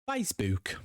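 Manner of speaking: put-on a quick jokey Geordie accent. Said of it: "Bad accent, I know".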